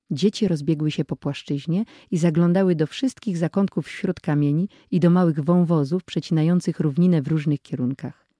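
usual speaking voice

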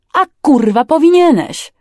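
loud, high-pitched rebuke; angry retort. Acted. Older man scolding his careless crewman, angry. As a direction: throw it back at him furiously. Boss snaps back in quarrel.

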